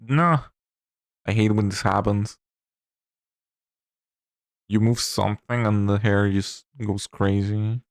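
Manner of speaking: normal talking voice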